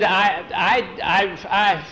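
storyteller voicing a tongue-tied boy; acted stammer. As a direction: stumble on the word, unable to go on. Boy stammers as foreman counts.

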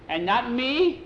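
high, rising protest; put-out boyish query; indignant question. Acted. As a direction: protest being left out, voice climbing. Passed-over boy protests.

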